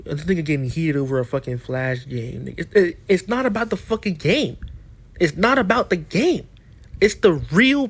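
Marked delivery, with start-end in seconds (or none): 0.0-2.5 low, softer mock of the critics; mocking quote. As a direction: imitate their dismissive jab, low and quiet. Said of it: "You talking about some".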